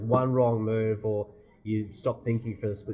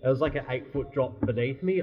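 normal talking voice

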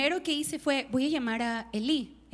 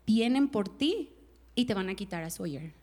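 ominous inner voice; 6 semitones lower, 4 dB quieter than usual. Retold or imitated. A voice she heard.

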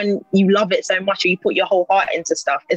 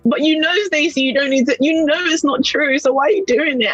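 self-talk while crying; 7 semitones higher than usual. Retold or imitated. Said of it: "I'm talking to myself whilst I'm crying".

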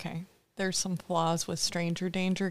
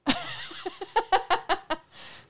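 high laughing burst; 13 semitones higher than usual. Real, not acted. Laughs at name Frank.

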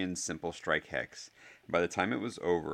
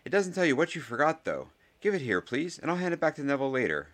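high, raised, insistent schoolboy; Ernie's schoolboy voice; 9 semitones higher, 5 dB louder than usual. A narrator's reading. Ernie asks for the ball back, high and louder. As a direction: pitch well up and speak up.

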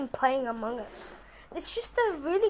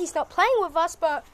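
normal talking voice

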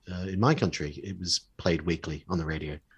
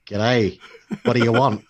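put-on Australian accent; 3 semitones higher, 6 dB louder than usual. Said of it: "I can't even do an Australian accent anymore".

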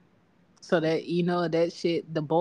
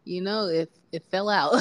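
ordinary talk

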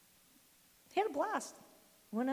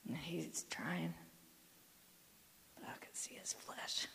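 whisper; 6 semitones lower, 13 dB quieter, slower than usual. Real, not acted.

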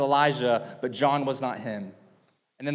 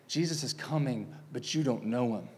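quiet warning; 4 semitones lower, 8 dB quieter than usual. Retold or imitated.

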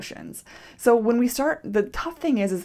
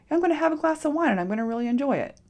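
higher and fast, bright with anticipation; cheerful; 6 semitones higher, faster than usual. Retold or imitated. Pouring wine to herself, a happy quick voice. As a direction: sound like you're looking forward to it. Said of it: "Or are you going".

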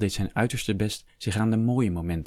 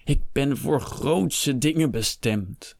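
high, drawn-out protest; proud, anguished declaiming; dramatic lament; 6 semitones higher, slower than usual. A narrator's reading. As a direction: lament it grandly, stretching the words. Doomed nobleman laments his fate.

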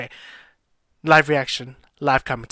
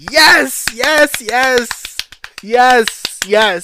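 loud, high, jubilant yeses; excited cheering; 8 semitones higher, 7 dB louder, much slower than usual. Real, not acted. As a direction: shout each yes like a celebrating fan. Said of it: "'cause I'm excited".

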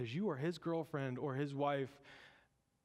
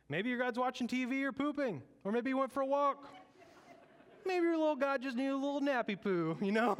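much higher, louder sing-song taunt; mocking Elijah; 10 semitones higher, 4 dB louder than usual. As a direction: tease the prophets like a cheeky kid. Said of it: "Elijah is saying".

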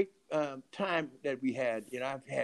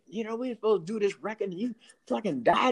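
higher-pitched, laughing indignation; mock scolding; 8 semitones higher, 4 dB louder than usual. Poet retelling how he scolded his dead friend, mock outrage. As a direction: berate a friend in playful disbelief.

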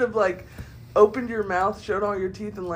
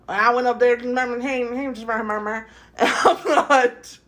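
put-on Southern accent; 3 semitones higher, 5 dB louder than usual. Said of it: "like that Southern Belle type of sound".

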